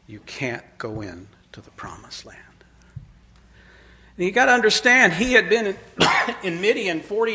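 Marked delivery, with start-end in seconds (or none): 0.1-2.4 whispered, low and grave; hushed verdict. Retold or imitated. The Lord telling Moses he can't enter, whispered verdict. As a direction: breathe the sentence out almost silently.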